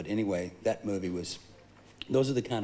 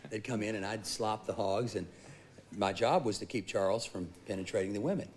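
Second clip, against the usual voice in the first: ordinary delivery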